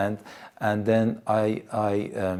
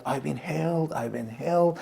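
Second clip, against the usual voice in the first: retold joyful shout; 6 semitones higher than usual. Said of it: "I started shouting".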